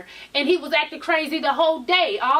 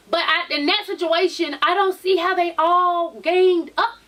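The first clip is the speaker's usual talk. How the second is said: usual speaking voice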